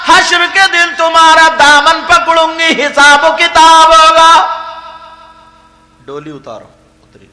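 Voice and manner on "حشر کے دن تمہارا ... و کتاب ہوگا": loud chanted lament, drawn out; wailing plea. A narrator's reading. Woman wailing her ruin to the king, loud chanted lament. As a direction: cry out to the king as if ruined. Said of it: "چلانے لگی".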